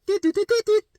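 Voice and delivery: high sharp mock beeping; high chirpy phone beeps; imitated phone beeps. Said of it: "it's like".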